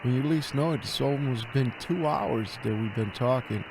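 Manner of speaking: usual speaking voice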